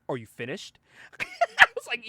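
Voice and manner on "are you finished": low, soft, dry question; understated, withering little question; unimpressed deadpan. Retold or imitated. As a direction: drop it low and quiet. Batman's curt reply after outpouring.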